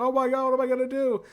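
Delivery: high, panicky put-on voice; panicked Ash. Ash facing his girlfriend's body with a chainsaw, high mock panic. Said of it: "And he's like".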